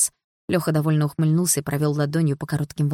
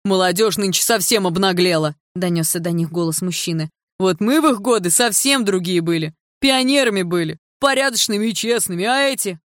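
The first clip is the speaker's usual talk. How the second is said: put-on grumbling old man; 2 semitones higher, 4 dB louder than usual. A narrator's reading. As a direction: rant about the youth of today.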